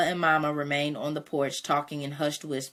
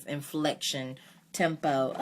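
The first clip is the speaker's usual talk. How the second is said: plain reading voice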